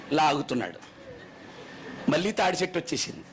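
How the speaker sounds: ordinary talk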